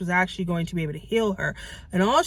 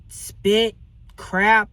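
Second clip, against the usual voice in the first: ordinary delivery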